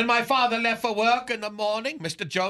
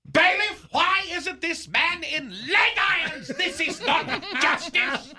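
outraged demand; 6 semitones higher than usual. Acted. Outraged demand in imagined court.

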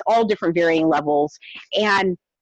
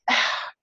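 heavy, voiceless sigh; soft, fed-up exhale; exasperated sigh; 6 dB quieter than usual. Real, not acted. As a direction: let out a sigh of disbelief. Exasperated at the messages she received.

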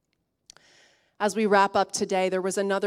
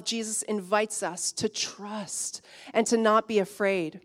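usual speaking voice

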